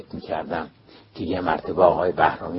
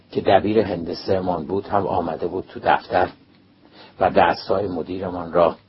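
ordinary delivery, nothing marked

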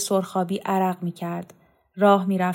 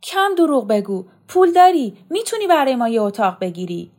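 much higher and louder furious shout, peaking on پول داری; shouting at mother; 5 semitones higher, 6 dB louder than usual. Acted. Son accusing his mother of hiding money, shouting. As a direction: yell the accusation at her with everything. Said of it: "برزو شبی فریاد زد".

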